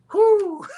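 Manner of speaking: high, loud whoosh of relief; relieved whew. Retold or imitated. Telling the story of Jason's reassurance, a high relieved whew. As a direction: let all the worry out in one breath.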